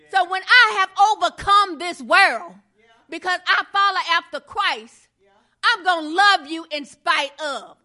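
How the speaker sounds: loud, driving preacher's cadence; full-force preaching. Acted. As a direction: hammer each line home to the church.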